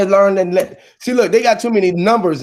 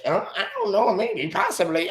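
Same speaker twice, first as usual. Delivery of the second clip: quiet mock broken-English accent; 3 semitones lower, 6 dB quieter than usual. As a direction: shrug it off in a put-on Spanish accent. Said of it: "You will speak in that broken English".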